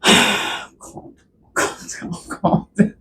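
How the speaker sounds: sigh, then whisper yourself down from a rant; whispered self-soothing. Real, not acted. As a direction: breath-only whisper to oneself. Calming themself after the outburst.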